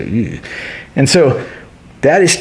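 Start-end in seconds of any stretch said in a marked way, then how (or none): 0.0-0.4 disgusted ew. Shown as a demonstration. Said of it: "I smell something gross, I go".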